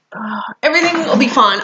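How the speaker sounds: normal talking voice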